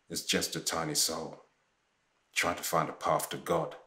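low, very soft and understated; said simply. A narrator's reading. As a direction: say it simply, quietly, almost under the breath. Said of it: "who said simply".

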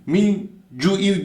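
"cu'iv" with the u cut short. Shown as a demonstration. Quran teacher showing the error, u unstretched. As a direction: say "cu'iv" without holding the vowel. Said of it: "Bu u uzatılmıyor".

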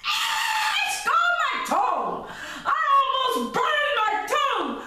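very high, loud, laughing bear shout; Papa Bear burned. Acted. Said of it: "Papa Bear tastes this porridge and he said".

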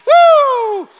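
high, loud woo; a real joyful holler; excited whoop. Real, not acted. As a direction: let out a big church whoop. Preacher whoops at congregation.

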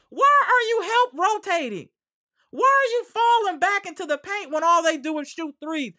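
high, loud scolding questions; exasperated. Real, not acted. Exasperated rant about team defense.

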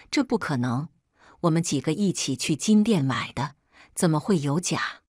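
usual speaking voice

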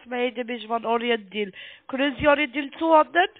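ordinary delivery

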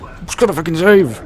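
high, exasperated complaint; overreacting. Real, not acted. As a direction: protest as if badly wronged. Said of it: "Am I overreacting?".

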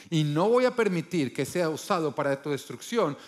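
ordinary talk

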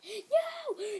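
very high squealing panic; hammed-up pleading. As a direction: beg in a tiny squeaky wail. Pig begs not to be killed.

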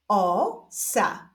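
stretched first syllable, pause, then second; drawn-out, divided syllables; syllable reading. Shown as a demonstration. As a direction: read it slowly as two separate syllables. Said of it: "doczytujemy sylabę różową".

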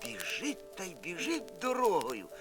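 ordinary delivery, nothing marked

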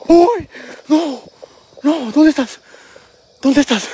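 high-pitched startled cries; startled alarm. Real, not acted. Ghost hunter calling to the unseen Llorona, startled.

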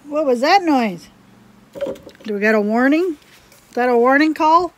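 real surprise at an unexpected sound; startled. Real, not acted. Crafter hears a strange noise mid-video, startled questioning.

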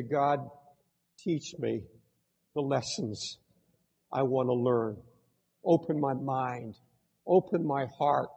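soft, low, surrendering plea; humble quiet prayer. Pretend. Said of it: "stand still and say".

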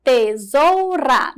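Tesoura drawn out slowly, each syllable clear, ra rolled; teacher spelling out Tesoura, slow. Shown as a demonstration. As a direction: sound out Tesoura very slowly for children. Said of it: "E o R e o A forma ra".

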